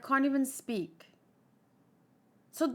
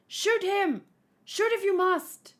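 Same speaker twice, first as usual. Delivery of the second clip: high, raised shouted order; acted commanding shout, pitched up; 8 semitones higher, 4 dB louder than usual. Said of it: "he's not shouting it".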